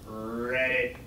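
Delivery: low croaking "Read it" like a frog. Retold or imitated. The frog answers the chicken, croaking low. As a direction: croak it out deep and soft. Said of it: "Yeah, like a frog".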